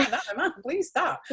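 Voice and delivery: high-pitched, frantic mock begging with a laugh; hammed-up horrified protest; mock panicked pleading. Begging friends not to do it.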